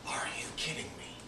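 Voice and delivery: breathless disbelieving whisper; hushed shock; stunned whisper. Retold or imitated. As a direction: whisper it like you can't believe your eyes. Stunned at rack of logo shirts.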